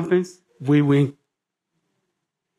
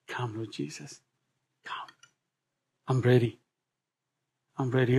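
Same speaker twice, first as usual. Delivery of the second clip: much lower, near silent, longing; hushed prayer; 6 semitones lower, 10 dB quieter than usual. Acted. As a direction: breathe it out as a quiet plea.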